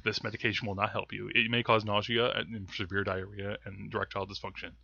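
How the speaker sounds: low, fast drug-ad disclaimer; fast legal disclaimer. Pretend. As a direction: rattle off side effects quietly. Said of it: "It also causes low, fast talking".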